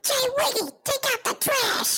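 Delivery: squeaky high voice shouting; high-pitched yell. Acted. Shouted chore call, clip-like.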